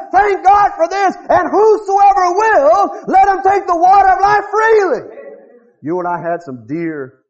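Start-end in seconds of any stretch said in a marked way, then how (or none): 0.1-5.2 loud, high, slow preacher's cry; full-force preaching. Acted. Preacher crying out to the congregation, loud and slow. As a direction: thunder it from the pulpit.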